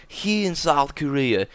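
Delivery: mock nature-doc narration; documentary narrator. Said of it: "documentaries from the nineties where it's like".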